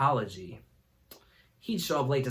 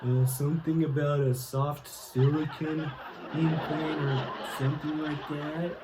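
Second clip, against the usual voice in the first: slow, laughing, dodgy answer; sheepish excuse; 4 dB quieter than usual. Retold or imitated. Roger dodging professor's question, slow sheepish laughing. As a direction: stall through a silly lie. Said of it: "dumbest and lamest excuses".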